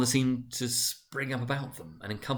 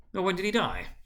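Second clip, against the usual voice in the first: a higher curious merchant; second businessman, higher; 9 semitones higher than usual. A narrator's reading. Another merchant asking the fat man, higher voice. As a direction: ask it up high, gossiping. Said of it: "inquired another".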